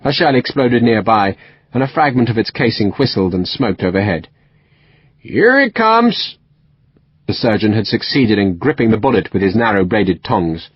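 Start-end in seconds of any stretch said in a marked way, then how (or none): 5.2-6.4 much higher, slow triumph; triumphant West Country. A narrator's reading. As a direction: draw it out as the bullet comes. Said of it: "strong West Country accent".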